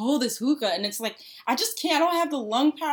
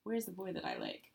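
very soft, low near-murmur; private wondering; 4 semitones lower, 15 dB quieter than usual. Retold or imitated. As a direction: wonder it under your breath. Retelling a private thought, searching.

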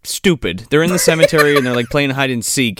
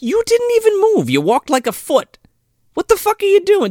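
high exasperated disbelief; mock exasperated yell; incredulous. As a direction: scold the girl in mock disbelief. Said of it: "And I'm like".

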